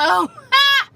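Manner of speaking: high shrieking laugh; loud laugh. Real, not acted. Burst of laughter at typo.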